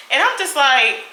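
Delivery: ordinary talk, nothing marked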